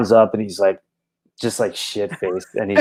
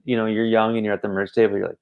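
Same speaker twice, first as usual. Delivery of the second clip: ordinary delivery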